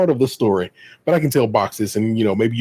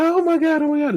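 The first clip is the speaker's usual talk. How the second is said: high-pitched, stunned repetition; surprised. Retold or imitated. Retelling mama seeing her son at the door, astonished. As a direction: imitate an overwhelmed mother, pitched way up. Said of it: "she's so surprised".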